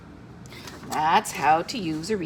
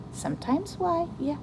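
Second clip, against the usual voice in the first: normal talking voice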